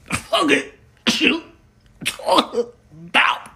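"The fuck is you talking about?" slow and slurred, as if passing out; passed-out slur. Shown as a demonstration. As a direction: drag it out like you're half passed out. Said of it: "if you're not passed out, you're not saying it right".

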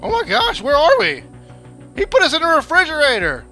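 high alarmed gasping talk; shocked. Real, not acted. Waking up captured in fridge.

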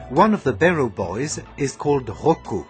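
ordinary talk

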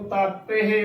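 "ਤਾਪੇ" with long aa and final he: taa-pe-he; correct pronunciation. Shown as a demonstration. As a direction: lengthen the first vowel of "ਤਾਪੇ" and sound the final he. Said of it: "ਸੋ ਤਾਪੇ ਉਚਾਰਨ ਕਰਨਾ".

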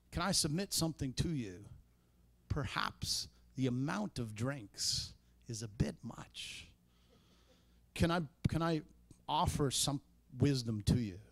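a lightly voiced, gentle suggestion; humble gentle tone. Shown as a demonstration. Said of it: "See the humility".

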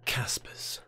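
breathy stage whisper; spooky whispered aside. Acted. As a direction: whisper the name like a secret. Whispered name after intro.

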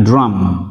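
"Drum" with an open "ah" vowel, falling. Shown as a demonstration. Teacher explains the U in "Drum", saying it as "ah". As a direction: say "Drum" as drahm. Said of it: "U đọc là Á".

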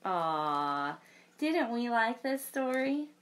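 plain reading voice

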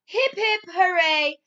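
loud, high cheer. Acted. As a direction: shout a happy hooray. Cheer for Red Lizard.

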